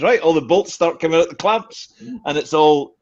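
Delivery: ordinary delivery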